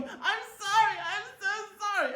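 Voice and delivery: high, loud wailing apology; hammed-up sobbing wail; mock bawling. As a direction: imitate a girl bawling, drawn out and high. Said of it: "And she's bawling".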